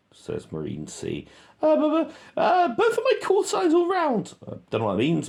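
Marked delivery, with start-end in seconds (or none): 1.6-4.3 much higher, louder mock radio talk; squeaky radio voice. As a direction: mimic a soldier on the radio, squeaky. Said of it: "he's speaking on the radio".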